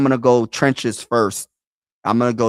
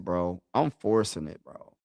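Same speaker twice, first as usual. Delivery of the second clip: usual speaking voice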